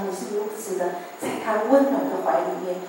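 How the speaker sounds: normal talking voice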